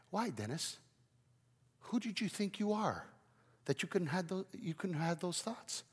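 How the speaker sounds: soft, searching question; God questioning. Acted. God questions the preacher about his thoughts, softly. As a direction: keep it hushed as you challenge him. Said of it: "And God says".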